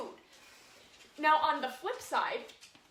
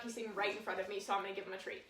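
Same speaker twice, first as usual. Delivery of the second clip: ordinary talk, nothing marked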